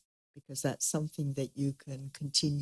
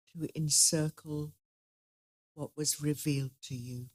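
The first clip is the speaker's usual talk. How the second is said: soft, unhurried meditation guide; guided meditation; 4 dB quieter than usual. Acted. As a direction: gently lead the group inward.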